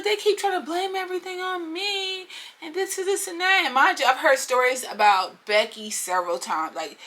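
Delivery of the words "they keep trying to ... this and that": high, whiny mock lament; hammed-up high wail, sustained; upset complainer. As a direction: imitate a tearful friend complaining. Acts upset Becky complaining.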